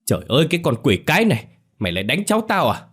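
high, fast furious scolding; an old woman lashing out to protect her grandson; angry snapping grandmother. A narrator's reading. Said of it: "bà tức giận gắt lên". (bà nội tức giận gắt lên)

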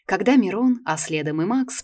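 plain reading voice

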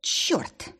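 whispered curse on an exhale; breathed curse. Acted. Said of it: "и я выдохнула".

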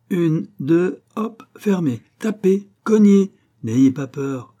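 high, loud hup-two commands; excited urging. A narrator's reading. Old locksmith making Malouin test the door, excited urging. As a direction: urge him on like a showman. Old man urges him to slam it.

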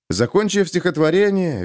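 usual speaking voice

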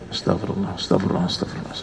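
low, hushed repeated muttering; mock-pious murmur under the breath; muttered istighfar. Friend spotting bikinis on the beach, muttering for forgiveness. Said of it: "Dia lihat".

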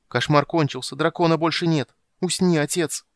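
high, loud, weeping plea; tearful pleading. Acted. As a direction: beg him to rest through tears. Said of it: "с плачем стала умолять его".

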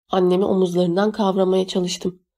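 usual speaking voice

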